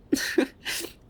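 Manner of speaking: short, airy laugh; teasing little laugh; amused laugh. Acted. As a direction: laugh at their expense. Teasing the listener over secrets.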